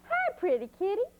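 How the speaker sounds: high, sing-song greeting; little girl cooing to a cat; sweet girl greeting. A narrator's reading.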